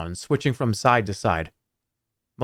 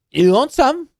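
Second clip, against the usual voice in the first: much higher, louder dwarf offering bread; bad Scottish accent; 13 semitones higher, 6 dB louder than usual. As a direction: offer it in a squeaky Scottish brogue. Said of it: "we're gonna do a different dwarf".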